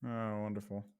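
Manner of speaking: usual speaking voice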